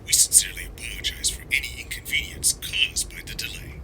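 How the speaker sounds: softly whispered train announcement. Acted. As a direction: sound like a distant station speaker.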